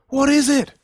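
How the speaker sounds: very high, loud panicked cry. Acted. Terrified boy asking his friend about the zombie, panicked. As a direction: shriek it in terror.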